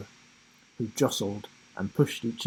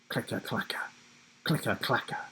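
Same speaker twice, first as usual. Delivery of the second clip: gnashing sound effect; 7 dB quieter than usual. A narrator's reading. Voids gnashing fangs.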